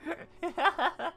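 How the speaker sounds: high squeaky giggle. Real, not acted. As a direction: giggle in a cartoon voice. Laughs after panic.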